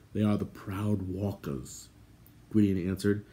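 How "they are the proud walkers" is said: hushed answer. A narrator's reading. Gwydion explaining the warriors to Terran, hushed. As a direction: answer softly and slowly from hiding.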